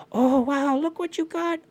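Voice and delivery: very high, small bird voices; high-pitched awed grandchildren; amazed little birds. Acted. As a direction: marvel in a high chirp. Little bird grandchildren marvel at the salmon.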